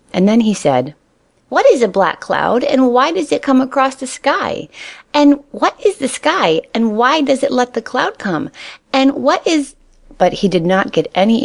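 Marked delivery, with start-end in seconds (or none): 1.5-9.8 put-on high, quick-fire childlike questions; a bunny who can't stop asking; questioning little rabbit. A narrator's reading.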